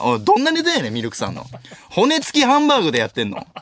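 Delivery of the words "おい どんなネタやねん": incredulous retort. Real, not acted.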